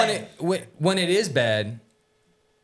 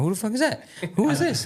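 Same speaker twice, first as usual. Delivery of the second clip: mock baffled; 10 semitones higher than usual. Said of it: "And I'm like".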